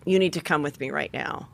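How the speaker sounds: lightly voiced officer, low and soft; a hint of quiet authority, pitch dropped; stern officer. Retold order from female officer.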